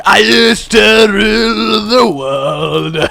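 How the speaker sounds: slow, loud, high guttural Scottish belt; Scottish accent. Pretend. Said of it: "sung in a guttural Scottish accent".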